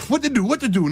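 panicking. Retold or imitated. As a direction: sound lost and scrambling. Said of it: "Now I'm panicking".

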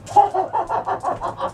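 long, high rattling giggle; cackling laugh. Pretend. Smoker tickled by the police taunt, long cackle.

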